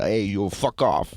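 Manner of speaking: put-on slow, tough Boston accent; put-on Boston tough guy accent. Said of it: "the Boston, Massachusetts guy".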